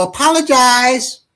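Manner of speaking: put-on high piping command from a toddler; little girl demand. Three-year-old daughter demanding an apology, high and bossy. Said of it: "she said".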